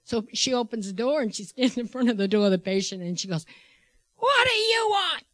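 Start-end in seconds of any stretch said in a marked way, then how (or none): 4.2-5.2 high, loud mock screech; imitated angry shriek, pitched way up; retold yelling. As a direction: yell it as the enraged patient. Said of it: "she can hear the yelling".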